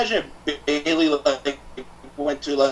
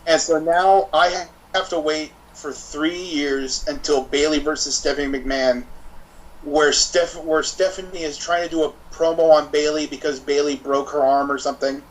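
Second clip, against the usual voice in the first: ordinary delivery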